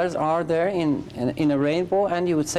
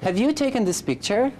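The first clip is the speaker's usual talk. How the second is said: "Have you taken this picture?" said higher with a clear rise at the end; question intonation; 5 semitones higher than usual. Shown as a demonstration. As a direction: repeat the model question, rising on "you" and at the close. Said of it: "be careful about your intonation".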